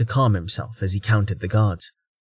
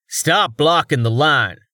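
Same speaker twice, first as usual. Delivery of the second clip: slow, louder bark of an impatient guard; shouted; 4 semitones higher, 4 dB louder than usual. A narrator's reading. Constable yelling at Rain blocking the gate, a slow shout. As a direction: shove the words into his face. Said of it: "the man shouted in Rain's face".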